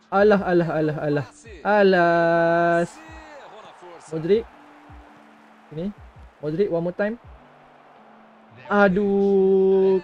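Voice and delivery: ordinary talk, nothing marked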